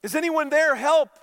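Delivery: high, loud desperate calls; yelling for help. Acted. Jack dangling from a branch, yelling loud for help. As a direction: shout for help from a cliff. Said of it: "And Jack said".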